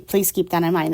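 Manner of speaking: ordinary talk, nothing marked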